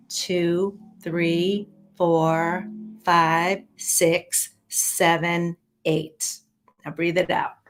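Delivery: guided meditation. Acted. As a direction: guide them calmly through each breath. Leads breathing and counting.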